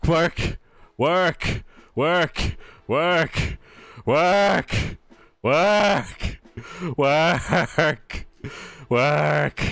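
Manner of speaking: weary high-pitched begging; pleading with a machine. Real, not acted. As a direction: keep begging, starting to tire. Still begging, a little lower.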